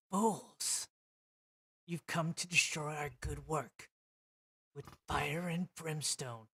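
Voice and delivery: a dark echoing voice from nowhere; villain voice. Acted. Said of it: "It's just a voice that kind of echoes".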